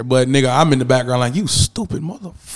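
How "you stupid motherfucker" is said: muttered insult, acted. Voices his background reaction to character.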